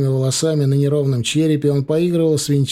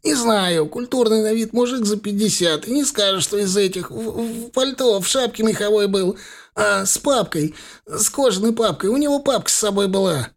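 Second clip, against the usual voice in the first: frightened babble; 8 semitones higher than usual. A narrator's reading. Thug blurts description in pain.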